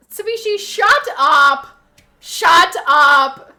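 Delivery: loud, drawn-out shut up; scolding shout. Real, not acted. Streamer telling off a teasing viewer, loud scolding.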